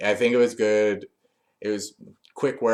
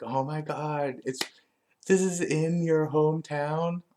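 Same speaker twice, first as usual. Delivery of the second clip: higher, put-on excited gush; mock excitement; 7 semitones higher than usual. Alexa gushing in mock excitement to Bayley. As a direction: fake delight at the news. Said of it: "Alexa gets really excited".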